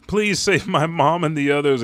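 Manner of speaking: imitated crying kid. Kid pleading for mom to be saved, crying. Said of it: "A kid is crying".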